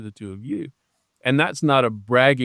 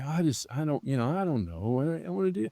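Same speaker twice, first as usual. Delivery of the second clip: softer, aimless mumbling to oneself; mock aimlessness; 8 dB quieter, faster than usual. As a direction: play someone quietly at a loss. Voicing someone waking without purpose.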